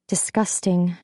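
plain reading voice

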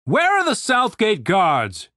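imperious commander calling for guards; booming demand. A narrator's reading. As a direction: demand it from high on the wall. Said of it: "demanded the voice from the wall".

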